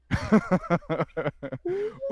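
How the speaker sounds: loud, high burst of laughter; laughing. Real, not acted. Husband cracking up at his wife's remark, loud laughter. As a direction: crack up loudly.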